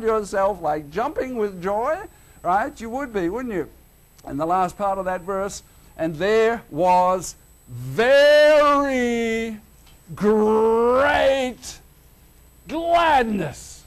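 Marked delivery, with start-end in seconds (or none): 6.0-13.7 very slow, louder "very great gladness"; understated reading. Shown as a demonstration. Preacher reading the verse, mocking its understatement, slow. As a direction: drag out each word with mock gravity. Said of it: "that's a British understatement".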